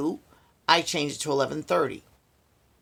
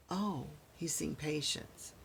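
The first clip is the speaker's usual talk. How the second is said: flat, slow, much softer mock repeat; sarcastic echo; 15 dB quieter, slower than usual. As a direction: echo her excuse with dry sarcasm. Mocking the nurse's excuse.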